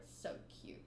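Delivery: whispered, very soft gush; softly gushing over the panel. Real, not acted. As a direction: breathe it out as a secret. Whispered aside while reading.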